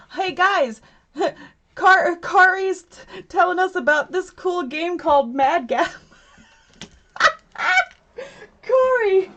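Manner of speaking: high, loud delighted greeting with laughter; excited squeal. Real, not acted. Greeting her friend Kari in live chat, squealing with laughter.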